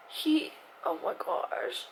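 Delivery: slow high tearful whisper; crying, worn out. Real, not acted. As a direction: choke out the words slowly. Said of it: "I can't stop crying".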